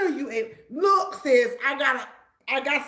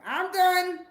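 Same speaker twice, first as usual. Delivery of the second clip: playful sign-off; 6 semitones higher than usual. Real, not acted. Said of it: "Y'all don't wanna hear me talk".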